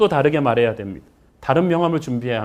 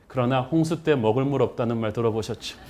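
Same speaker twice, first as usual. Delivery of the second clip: ordinary talk, nothing marked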